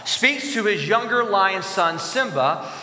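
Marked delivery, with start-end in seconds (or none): none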